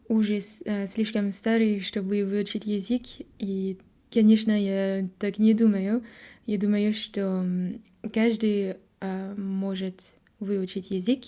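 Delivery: normal talking voice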